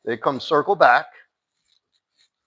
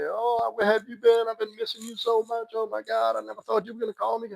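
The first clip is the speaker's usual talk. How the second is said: high, desperate clingy gushing, fast at the end; mock needy ex; 10 semitones higher than usual. Mocking the ex who gushes when he calls back, high and needy. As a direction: play the overeager girlfriend who's been waiting by the phone. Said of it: "Don't say".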